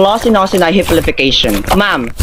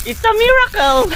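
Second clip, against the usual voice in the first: high, drawn-out cry of wonder; mock amazement; slower than usual. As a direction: proclaim a miracle, stretched and high. Feigned cure announced to the doctor. (kunwaring manghang-mangha)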